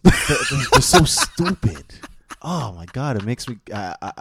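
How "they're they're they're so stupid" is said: high, loud words breaking into laughter; laughing through exasperation; exasperated laughing. Real, not acted. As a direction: laugh in disbelief at their stupidity. Exasperated laugh about other people.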